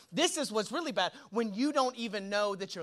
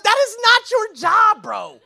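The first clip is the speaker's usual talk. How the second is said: exclaiming; 10 semitones higher, 13 dB louder, slower than usual. Real, not acted. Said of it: "preacher shouting at congregation".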